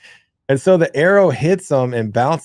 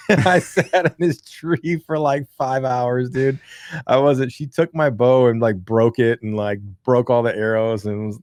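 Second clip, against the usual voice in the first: ordinary talk, nothing marked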